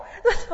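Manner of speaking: loud burst of laughter. Real, not acted. Laughing at her own shout in church, loud laugh.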